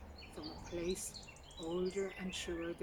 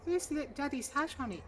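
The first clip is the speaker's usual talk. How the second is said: higher, tense mother asking; worried mother; 9 semitones higher, 8 dB louder than usual. A narrator's reading. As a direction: ask gently but anxiously. Mother asks child.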